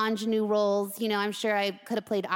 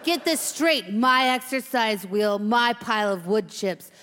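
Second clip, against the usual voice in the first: slow menacing warning; tough veteran hamster, hostile; 5 dB louder, slower than usual. Acted. Old cellmate warning new hamster, hostile. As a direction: lay down the law to a rookie.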